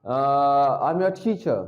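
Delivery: ordinary talk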